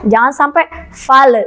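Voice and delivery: normal talking voice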